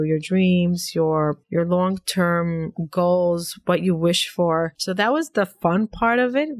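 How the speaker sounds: usual speaking voice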